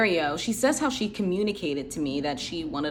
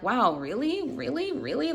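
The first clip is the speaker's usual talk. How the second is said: high, rising stunned really; surprised at friends' compliments, rising disbelief; 7 semitones higher than usual. Retold or imitated. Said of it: "I'm like".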